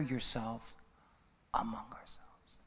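whispered aside. Acted. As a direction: drop to a breathy whisper. Preacher drops to whisper.

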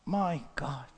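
low, very soft, near whisper with long pauses; hushed, grave preaching. Real, not acted. Preacher mourning a pastor's lost moment, hushed and grave. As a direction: let it sink in almost under the breath.

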